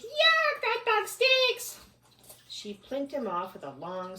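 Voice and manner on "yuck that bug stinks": very high, loud, slow yuck; disgusted shriek. Acted. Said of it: "she shrieked".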